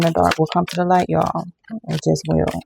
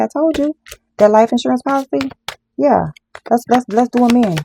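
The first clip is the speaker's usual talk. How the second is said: higher-pitched conspiring voice acting out the plotters; scheming plotters; 6 semitones higher than usual. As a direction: play the sly schemers hatching their plan. Voicing schemers plotting murder.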